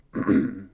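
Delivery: loud preparatory throat clear. Acted. King before addressing seated guests, loud throat clearing. King readies himself for speech.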